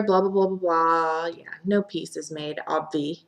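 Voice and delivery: ordinary delivery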